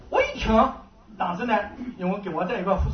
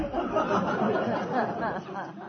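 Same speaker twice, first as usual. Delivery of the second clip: laugh; 6 dB quieter than usual. Real, not acted. Laughs at his own story.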